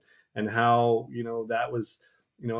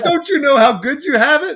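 loud, high, urging the new dad to do better; fired-up street pep talk; 11 dB louder than usual. Retold or imitated. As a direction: imitate him calling you out, big and loud. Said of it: "He's like".

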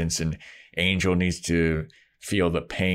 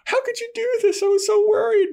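very high, hammed-up pleading; mock distressed girl; 4 dB louder than usual. Buffy confronting Angel after worrying about him, mock-distraught squeal. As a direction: imitate a worried girlfriend way up high. Voices worried, hurt Buffy.

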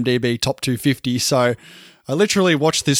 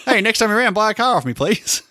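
higher, grinning salesman wheedle; sly wheedling grin; 8 semitones higher than usual. Retold or imitated. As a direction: wheedle it like a cheeky car seller. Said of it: "can't blame a guy for trying".

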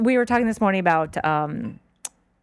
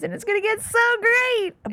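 singsong glee, pitched way up; gleeful anticipation; 13 semitones higher than usual. Real, not acted. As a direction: relish the coming chaos in a high voice. Gleeful anticipation of political chaos.

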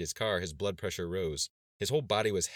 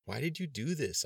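high, swooping incredulous question; can't believe what was done; acted astonished dismay; dismayed disbelief; 8 semitones higher than usual. A narrator's reading. Shocked friend confronts changed look.

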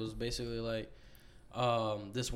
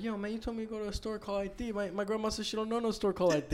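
higher, fast, a touch mock-accusing; indignant kid; 7 semitones higher, 3 dB quieter, faster than usual. Kid confronts older boy about the fake store, higher indignant. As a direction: confront him as a wronged little kid. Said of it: "I was like".

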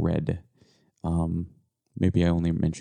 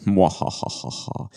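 evil laugh; slower than usual. Pretend. Villain laugh about plan.

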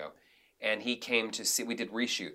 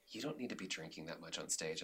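hushed warning; 3 semitones lower, 13 dB quieter than usual. Retold or imitated. Agent pulls him aside, hushed.

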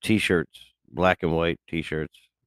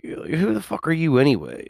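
high-pitched mock challenge; mock indignant comeback; 10 semitones higher than usual. Podcaster retelling how he talks to people, mock indignant. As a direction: challenge them with playful, exaggerated indignation.

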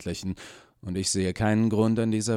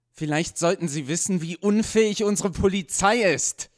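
bursts out much higher and louder; angry outburst; 11 semitones higher, 6 dB louder than usual. A narrator's reading. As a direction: blurt it out before he can stop himself. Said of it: "platzte es aus Gerald heraus".

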